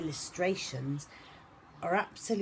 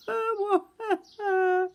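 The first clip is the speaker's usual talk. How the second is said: high mock wail back; echo sob; 7 dB louder than usual. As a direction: echo his sob across the pond. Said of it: "said the voice from across the pond".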